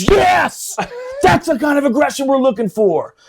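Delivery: high, elated yell of approval; hammed-up gleeful holler; happy, excited shout. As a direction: imitate a pumped-up man cheering them on. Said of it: "he was happy".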